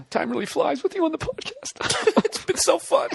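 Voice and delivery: sentimental line rising into fake sobs; hammed-up tearful squeal; mock crying. Shown as a demonstration. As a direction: burst into high pretend tears. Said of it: "then you cry, which ruins their crying".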